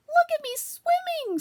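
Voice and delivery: put-on very high, slow squeaky baby voice; squeaky little Roo. A narrator's reading. Little Roo shows off his swimming, very high squeak. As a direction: squeak it out like a tiny proud joey. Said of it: "squeaked Roo".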